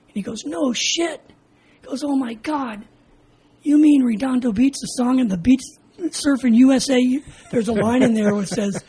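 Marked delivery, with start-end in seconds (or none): 0.5-1.2 higher-pitched retold amazement; excited disbelief. Officer learning where the recruit is from, girlishly amazed. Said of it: "he's like a teenage girl". 2.0-7.2 high, louder starstruck outburst; gushing like teenage girl. Retold or imitated. Officer hearing Redondo Beach, excited as a teenage girl. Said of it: "he's like a teenage girl".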